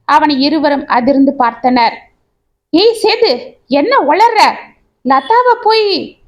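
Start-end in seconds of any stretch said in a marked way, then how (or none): 2.7-6.2 shocked protest. Acted. Friend shocked by accusation.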